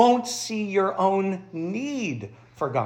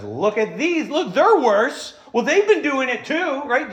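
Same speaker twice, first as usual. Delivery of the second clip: loud, slow, pointing finger; mock Pharisee self-justifying; smug accuser; 7 semitones higher, 6 dB louder than usual. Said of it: "let's all be Pharisees".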